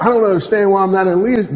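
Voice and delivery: a team member griping in Texas twang; Texas accent. Retold or imitated. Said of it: "That sounds like more Texas".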